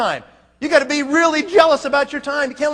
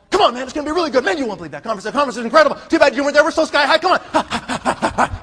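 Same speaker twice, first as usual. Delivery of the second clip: pumped-up pep talk; 2 semitones higher, faster than usual. Acted. Leader acts out pumping up his recruits, excited pep talk. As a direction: hype them up with acted enthusiasm. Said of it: "You pump 'em up".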